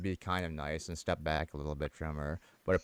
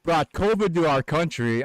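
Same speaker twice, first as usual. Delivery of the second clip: higher, louder, slow accusing line; mock accusation; 9 semitones higher, 10 dB louder, slower than usual. Lady accusing teller's people of bringing covid, slow mock accusation. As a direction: spell out the blame like a bigot. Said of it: "she looks at me and she goes".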